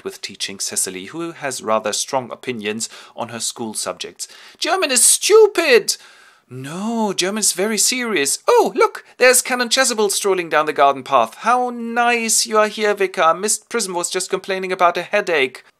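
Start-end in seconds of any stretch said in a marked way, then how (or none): 4.6-6.0 far higher, loud, slow whine; bratty shout. Acted. Cecily complaining to her governess, loud bratty shout. 6.5-15.6 higher, louder fussy voice; high-pitched governess. Acted. Cecily and Miss Prism in the garden, higher and louder.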